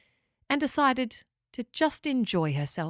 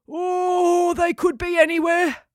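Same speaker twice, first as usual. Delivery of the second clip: drawn out yawning voice; 9 semitones higher, 6 dB louder, slower than usual. A narrator's reading. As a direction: say it through a yawn. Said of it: "yawned Steve".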